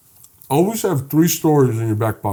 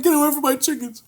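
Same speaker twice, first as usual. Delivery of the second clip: put-on higher, a kid yelling at a stranger; nine-year-old me. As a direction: play yourself as a small boy shooing him off. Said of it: "I'm nine years old".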